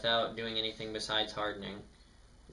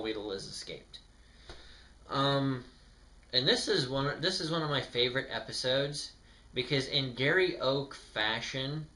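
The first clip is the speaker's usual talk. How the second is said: usual speaking voice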